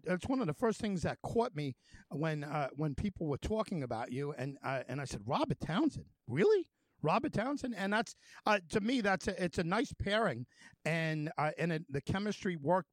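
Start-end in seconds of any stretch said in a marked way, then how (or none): 5.3-7.8 incredulous questioning, jumping high on Really; retold disbelief. Host recalling his reaction to the director's name, incredulous. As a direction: replay your own amazed double-take.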